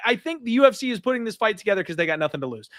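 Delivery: ordinary talk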